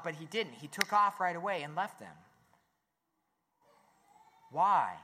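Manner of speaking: usual speaking voice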